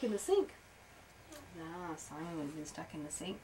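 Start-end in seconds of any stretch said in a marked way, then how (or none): none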